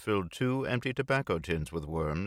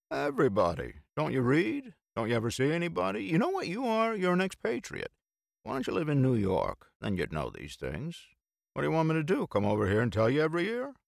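mock lecture; 9 semitones higher than usual. A narrator's reading. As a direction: lecture him in jest. Bill ranting.

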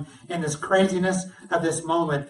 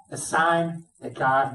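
usual speaking voice

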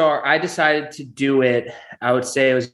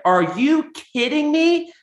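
much higher, slow disbelieving mother voice; mock shocked mom; slower than usual. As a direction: play mom squealing in disbelief, stretched out. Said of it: "And she's like".

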